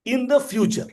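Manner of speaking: teacher leaning on "future", pitched high; teacher to students, "future" lifted high; stress on future. Shown as a demonstration. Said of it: "I'm emphasizing the word future".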